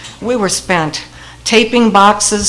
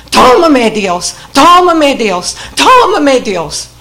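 imitating an old patient calling out down the hall, louder; a retold cry, lightly raised and repeated; mock yell; 5 semitones higher, 5 dB louder than usual. Old patient down the hall, imitated calling out louder. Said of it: "kept yelling about every half hour".

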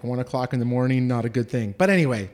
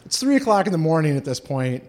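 ordinary delivery

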